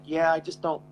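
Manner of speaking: usual speaking voice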